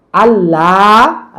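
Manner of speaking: আল্লাহ with a long held লা; short আল, drawn-out লা; long madd. Shown as a demonstration. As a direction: stretch the end of আল্লাহ slowly. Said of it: "আল্লাহ টান ছিল".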